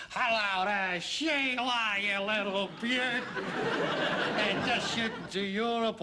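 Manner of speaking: hammed-up broad Australian accent. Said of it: "you can do an Australian accent".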